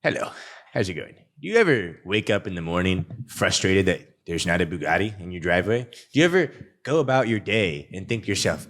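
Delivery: low, punchy sales-ad pitch. Acted. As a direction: sell it to camera like a guru ad. Ad pitch on camera.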